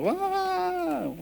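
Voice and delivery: high mock wail of crying; imitated crying wail. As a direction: squeal out her sob, imitating it. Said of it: "ella empieza a llorar". (imitando un llanto agudo)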